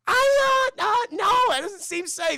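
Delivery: very high, loud, stammering squeal; panicky refusal. Acted. Hero refuses to give up healing factor, in mock panic.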